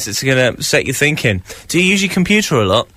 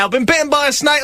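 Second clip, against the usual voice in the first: high-pitched mock panic; panicked cry; 11 semitones higher than usual. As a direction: cry it out like an urgent patient. Acts out rushing to the doctors.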